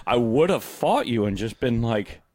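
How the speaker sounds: usual speaking voice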